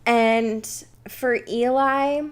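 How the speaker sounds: usual speaking voice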